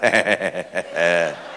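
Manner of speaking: a low chuckle at the blood; low laugh. Retold or imitated. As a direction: laugh it off lightly. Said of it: "he starts to laugh".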